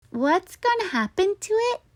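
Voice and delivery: put-on high little girl voice; curious little girl; little sister. A narrator's reading. Allison asks her brother about the tooth, high. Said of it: "asked Allison".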